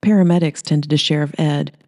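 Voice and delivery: ordinary delivery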